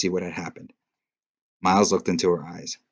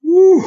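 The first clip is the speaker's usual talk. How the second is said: very high, loud whoop of relief; excited whoop; 15 dB louder than usual. Real, not acted. Reader whoops after finishing an intense chapter. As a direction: let out a big whoop.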